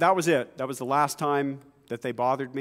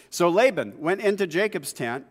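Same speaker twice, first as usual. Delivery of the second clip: ordinary delivery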